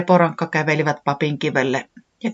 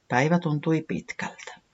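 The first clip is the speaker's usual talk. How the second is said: ordinary delivery, nothing marked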